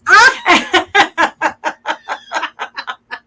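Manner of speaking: a loud, high burst of laughter; big laugh. Real, not acted. Showing the host her denim quilt, loud high laugh.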